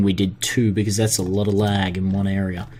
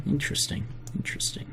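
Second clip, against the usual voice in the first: whispered musing; 5 semitones higher, 13 dB quieter, much faster than usual. Real, not acted. Musing over map change.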